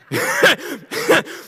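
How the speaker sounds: high burst of laughter; real laugh. Real, not acted. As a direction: laugh out at your own joke. Laughs at own story.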